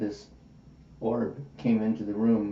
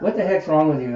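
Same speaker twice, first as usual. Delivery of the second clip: irritated retort; 6 semitones higher, 7 dB louder than usual. Retold or imitated. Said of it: "she's kinda getting irritated at me".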